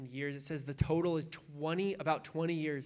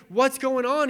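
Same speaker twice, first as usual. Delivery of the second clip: panicking; 7 semitones higher, 7 dB louder than usual. Retold or imitated. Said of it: "He's, like, panicking".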